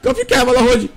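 high yelps and shouts; frantic yelling. Real, not acted. Gamer fighting Dóra shouts at her to calm down, frantic. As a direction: yell it out in a panic, pitch way up.

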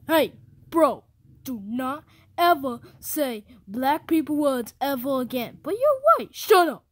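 loud, high yelling that climbs to the top; yelling at a friend never to say those words again; furious shout. Acted. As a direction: scream him down, shut him up.

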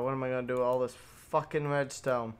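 ordinary talk

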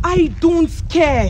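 high, slow, stretched-out dismissal; emphatic, slow refusal to listen; defiant dismissal. Acted. Bride dismisses the friend's defence.

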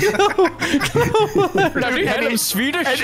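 high, drawn-out bad Swedish accent; laughing through a badly faked Swedish accent; failed Swedish, sounding Indian. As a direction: attempt Swedish, land in Indian. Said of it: "he's just immediately Indian".

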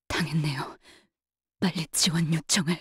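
low, hushed voice of someone hurt; wounded and weakened. Acted. Wounded guard requesting support, weakened hushed voice. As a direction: force out a faint plea for backup.

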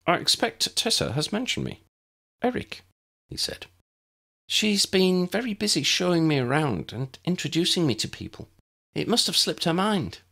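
eager prefect trying to impress; Eric's voice. A narrator's reading. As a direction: play the keen, slightly pompous schoolboy. Eric voiced in story.

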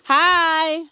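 loud, high shout across the ice; calling out. Real, not acted. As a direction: hail people passing at a distance. Calling to people passing by.

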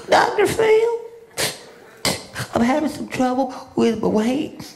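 high, pleading guest voice; tearful guest. Retold or imitated. Guest pleading with Doctor Phil, high and whiny. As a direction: plead up high like a teary talk-show guest. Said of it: "and she's like".

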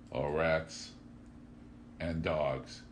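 usual speaking voice

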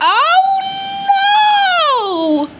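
high, loud cry of alarm; shocked at the attack. Real, not acted. Piggy attacks someone.

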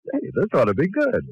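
ordinary talk, nothing marked

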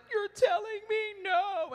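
high, slow, crying voice; tearful realization. Retold or imitated. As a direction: break into tears on the words. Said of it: "And then I cried".

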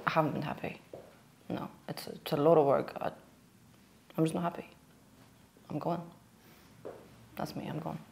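low, hushed mock-serious confession; deadpan serious. Pranking boss Alex about quitting, deadpan serious. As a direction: deliver the prank quietly, dead serious. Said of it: "stuff with a very serious face".

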